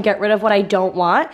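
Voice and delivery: ordinary talk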